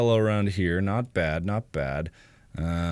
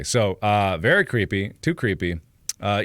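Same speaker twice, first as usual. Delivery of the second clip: ordinary talk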